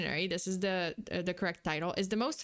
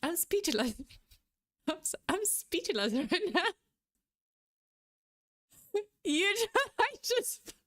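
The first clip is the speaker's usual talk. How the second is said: high, breaking words through real laughter; overcome, laughing to tears; 9 semitones higher than usual. Real, not acted. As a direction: struggle to get it out between laughs. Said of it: "I'm sorry, I'm crying".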